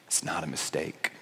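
very soft and low; hushed reassurance. Retold or imitated. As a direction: let the voice fall away gently. Jesus reassures, voice drops.

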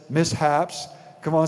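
usual speaking voice

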